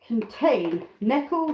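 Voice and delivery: ordinary delivery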